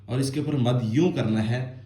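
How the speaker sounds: ordinary talk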